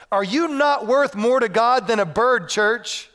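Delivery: slow, loud, high challenge; emphatic verse proclamation; forceful scripture preaching. Acted. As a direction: put the question squarely to the congregation.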